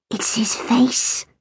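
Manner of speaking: slow breathy whisper. A narrator's reading. Katy whispering to Sandy about Hugh. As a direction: breathe it as a giggly secret. Hushed gossip between friends.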